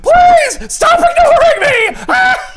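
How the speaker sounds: high, loud, slow hammed-up plea; mock desperate shout. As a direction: yell like a panicked ignored friend. Batman ignored in hell.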